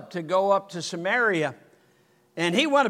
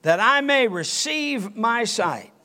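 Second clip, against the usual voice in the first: blind man pleading; 5 semitones higher, 5 dB louder, slower than usual. Acted.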